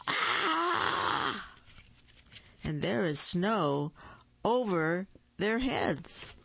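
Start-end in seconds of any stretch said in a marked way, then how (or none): 0.1-1.5 high drawn-out "ahh" scream; startled yell. A narrator's reading. Parents open the front door on snow, a startled yell. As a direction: scream high at a sudden shock. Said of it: "opened the front door and yelled".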